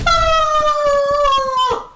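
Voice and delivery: high drawn-out "aah"; mock falling scream. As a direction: imitate someone falling from the sky. Imitates falling into jungle.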